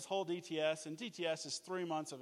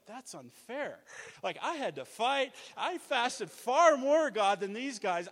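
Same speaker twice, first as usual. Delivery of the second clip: rising, louder, slower mock protest; mock indignant whine; 5 semitones higher, 8 dB louder, slower than usual. Preacher voicing veterans who resent the youngsters. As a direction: ham up the hard-done-by complaint. Mock complaint of the old-timers.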